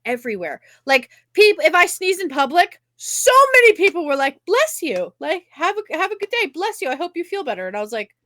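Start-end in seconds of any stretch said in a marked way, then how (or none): 4.5-7.7 high, sweet well-wisher voices; imitated gushing Southern niceness, pitched high; over-nice strangers. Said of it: "they're surface nice".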